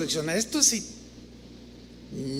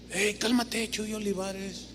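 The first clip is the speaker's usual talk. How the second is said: calming himself; 8 dB quieter, slower than usual. Retold or imitated. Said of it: "me veo al espejo".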